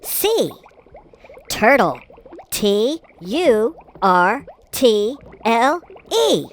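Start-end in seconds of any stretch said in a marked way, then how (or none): none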